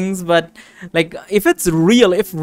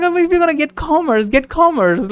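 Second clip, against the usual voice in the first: higher, sarcastic quote of the taunt; mocking friend; 10 semitones higher than usual. Streamer retells teasing a friend, higher sarcastic mock. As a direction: needle him playfully. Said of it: "I was like".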